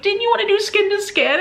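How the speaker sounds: high, eager mock question; husband imitation. Imitating her clueless husband.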